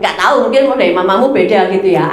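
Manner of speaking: usual speaking voice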